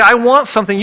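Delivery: put-on whiny child. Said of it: "your children come to you and say".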